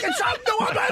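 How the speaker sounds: flustered giggling explanation; breaking into laughter while explaining; laughing. Real, not acted.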